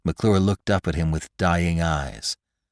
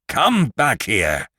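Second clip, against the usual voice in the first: slow, drawn-out shout after him; Lantry yelling after McClure as he flees; calling out; 5 semitones higher, much slower than usual. Acted. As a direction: bellow for him to come back. Lantry lunges after fleeing McClure.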